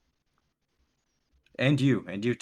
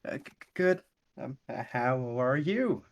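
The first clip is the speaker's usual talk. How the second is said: higher, slow stuttering reply; nervous stammer; 6 semitones higher than usual. Acted. Hoshiko nervously answering Kenichi, stuttering and slow. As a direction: stammer it out, scared to look up. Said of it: "looks up nervously and says".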